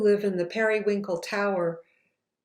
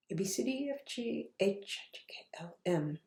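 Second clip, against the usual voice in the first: softer and softer down to a whisper; fading to whisper; 13 dB quieter, faster than usual. Real, not acted. Reciting letters while dialing, fading out. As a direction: let the letters fade away.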